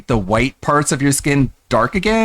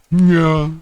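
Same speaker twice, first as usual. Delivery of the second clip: yes man voice; slower than usual. Retold or imitated. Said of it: "he's got Chris there to go".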